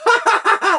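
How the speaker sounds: put-on high, loud head-back cackle of a toddler; imitated child laugh. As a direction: throw the head back and cackle like a little boy. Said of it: "throw his head back and laugh".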